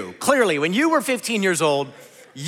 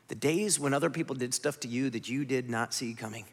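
soft reflective preaching; 12 semitones lower, 11 dB quieter than usual. Acted. Quiet reflective section of sermon.

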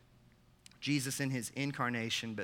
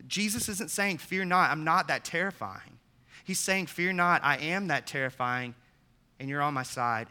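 loud, high, triumphant preaching; preaching at full force; 5 semitones higher, 6 dB louder than usual. Acted. Pastor contrasting the meek picture with the conquering king, full-force preaching. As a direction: proclaim it like a war cry of victory.

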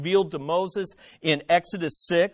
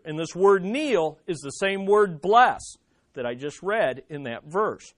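ordinary talk, nothing marked

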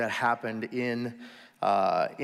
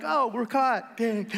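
very high cartoon fish, playfully disappointed; a little fish sighing at being caught; squeaky fish voice, mock dismay; 10 semitones higher, slower than usual. Fish realising they are caught.

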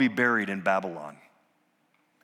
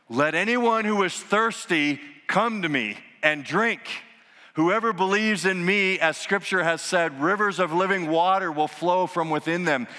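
loud voice; 6 semitones higher than usual. Retold or imitated. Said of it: "he said in a loud voice".

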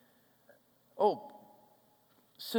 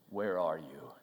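barely voiced, low and tender; hushed question; 10 dB quieter than usual. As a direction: ask it hushed, as God calling. God's question quoted softly.

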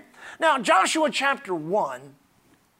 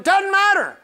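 high, forceful pulpit rebuttal; emphatic dismissal; 11 semitones higher, 7 dB louder, slower than usual. Real, not acted. Preacher answering "I can't feel it", emphatic high dismissal. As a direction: cut the objection down hard.

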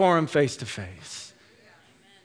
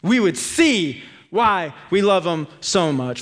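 ordinary talk, nothing marked

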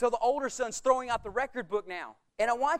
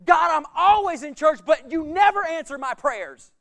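loud complaining plea; whiny churchgoer; 5 semitones higher, 9 dB louder than usual. Retold or imitated. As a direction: scold God like an owed customer. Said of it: "good church people".

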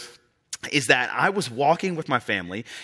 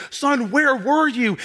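higher, slow, louder pleading father; worried dad; 12 semitones higher, 4 dB louder, slower than usual. Retold or imitated. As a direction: ask with frantic relief. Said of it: "he's like".

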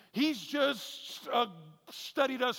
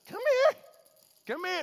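much higher, louder eager call; 10 semitones higher, 6 dB louder than usual. Retold or imitated. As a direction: call the fish in, almost squealing. Fisher calling fish with flashy lure.

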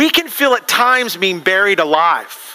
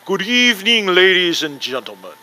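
Alfred Hitchcock. Retold or imitated. TV host greeting his audience, creepy British impression. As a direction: mimic a creepy British TV presenter. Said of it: "his kinda creepy British voice".

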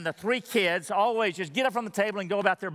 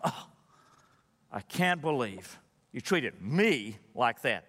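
mock grumbling prayer; 8 semitones lower, 4 dB quieter than usual. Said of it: "you just grumble and complain".

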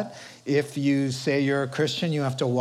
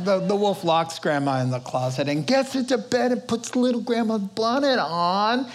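ordinary delivery

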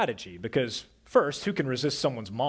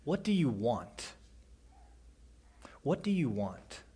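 softer, gentle "What do you want?" with a falling end; caring, quiet ask; tender question; 6 dB quieter than usual. Shown as a demonstration. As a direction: ask it kindly, inviting an answer. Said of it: "It's not said in that tone at all".

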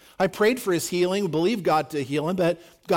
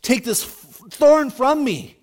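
loud, high, anguished begging cry; Paul crying out to God, desperate loud plea; desperate pleading; 5 semitones higher, 7 dB louder than usual. Acted. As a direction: beg God loudly to take the thorn away. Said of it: "he is desperately pleading".

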